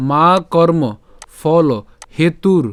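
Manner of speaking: মা কর্ম ফল হেতুর in separate, measured words; careful Sanskrit pronunciation. Shown as a demonstration. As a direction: close হেতুর on a clear r. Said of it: "রেফের উচ্চারণটা র হসন্ত পর্যন্ত উচ্চারণ". (আলাদা করে মা কর্ম ফল হেতুর, শেষে র্)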